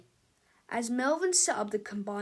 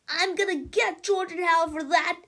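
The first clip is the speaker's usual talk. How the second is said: higher, louder spiteful voice; vengeful Melvin; 7 semitones higher, 7 dB louder than usual. Acted. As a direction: mutter a threat like a bratty genius. Melvin vows revenge.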